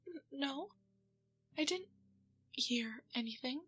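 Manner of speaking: ordinary talk, nothing marked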